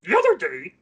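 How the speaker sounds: loud, high puppet voice, a little Grover-y; Grover-like cartoon uncle voice; Uncle Matt. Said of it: "that was very Grover-y".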